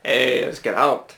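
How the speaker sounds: slow Yorkshire accent for a cartoon boar. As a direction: put on a drawn-out Yorkshire voice. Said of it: "He'd be from Yorkshire".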